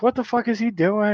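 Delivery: high-pitched mock-mad question; retold exasperation. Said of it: "I was so mad".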